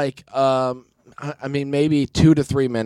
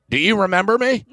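higher-pitched imitated question; hopeful question; 8 semitones higher, faster than usual. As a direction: ask it high, as if expecting recognition. Retold first ask of gate attendant.

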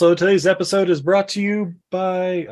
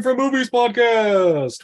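hype announcing; 4 semitones higher, slower than usual. Acted. Hyping contestants' names.

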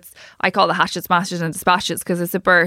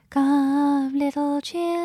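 put-on higher, soft, eerie child ghost; ghostly little girl; 5 semitones higher, 5 dB quieter, much slower than usual. Imitating haunted-house girls in Victorian dresses, ghostly little girl. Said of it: "little girls with long hair and Victorian dresses going".

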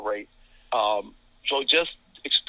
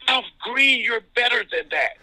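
high, loud yell across the arena; loud mock yell, pitched high; coach yelling his name; 9 semitones higher, 4 dB louder than usual. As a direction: call him out so the whole arena hears. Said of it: "he'd just yell it".

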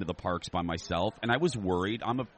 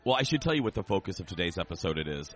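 ordinary talk, nothing marked

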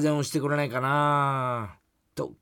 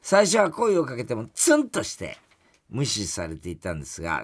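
normal talking voice

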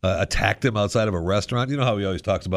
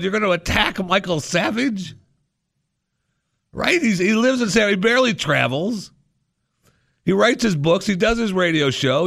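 higher, pitched-up disbelief; incredulous rant; 9 semitones higher than usual. Real, not acted. Host rants about fans attacking an aging radio man, incredulous and higher.

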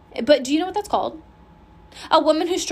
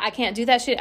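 ordinary talk

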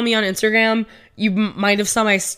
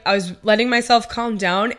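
usual speaking voice

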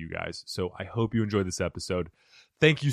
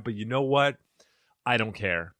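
ordinary talk, nothing marked

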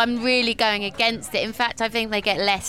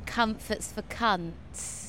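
quiet slow quote; 7 dB quieter, much slower than usual. Retold or imitated. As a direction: murmur it slowly like a motto. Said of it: "gave me the slogan".

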